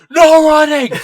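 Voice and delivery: high, very loud acted shout; mock bellow. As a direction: bellow it like a huge security guard stopping someone. Guard's shout acted in story.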